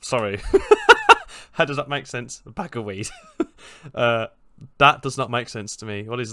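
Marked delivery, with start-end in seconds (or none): 0.4-1.5 high-pitched loud cackle; burst of laughter. Real, not acted. Laughing at own bag of weed joke. 3.1-3.9 high laugh. Real, not acted. Laughing at own joke again.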